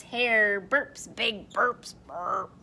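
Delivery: ordinary delivery, nothing marked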